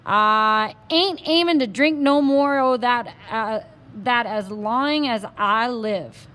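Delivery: slow, loud Texas cowboy; Texas accent. Acted. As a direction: drag it out like a stubborn Texas cowboy. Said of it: "in his strong Texas accent".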